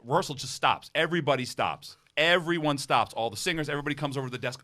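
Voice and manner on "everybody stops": ordinary delivery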